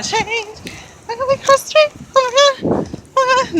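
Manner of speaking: very high squeal while hurrying; real squeaky, breathless urgency; high-pitched panic. Real, not acted. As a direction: squeak it out in a rush to cross. Rushing to beat the changing light.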